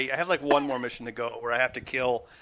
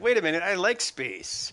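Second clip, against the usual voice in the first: higher, louder acted-out thought; mock realisation; 6 semitones higher, 5 dB louder than usual. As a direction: act out a delighted sudden realisation. Own sudden realisation, acted out.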